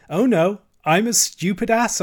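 high, loud mock self-reproach; boastful false modesty. A narrator's reading. Swelling Toad boasts to Mole, boastful false modesty. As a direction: exclaim it high, fishing for praise. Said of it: "Toad was puffing and swelling already".

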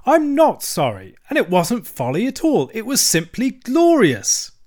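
stoutly defiant. A narrator's reading. Toad refusing to repent before the badger, stoutly defiant. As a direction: stand your ground, voice soaring. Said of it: "a little sullenly, but stoutly".

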